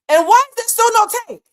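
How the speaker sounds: loud, high-pitched outburst; fed-up shouted question; exasperated yell. Retold or imitated. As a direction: demand it at the top of your voice. Story, fed up about tape.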